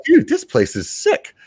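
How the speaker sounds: high, thrilled discovery; excited. Retold or imitated. Telling friends about finding Bentonville, high excited. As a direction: blurt it out, wide-eyed and high. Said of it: "I'm like".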